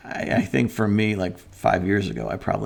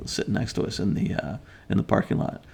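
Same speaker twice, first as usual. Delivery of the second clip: ordinary talk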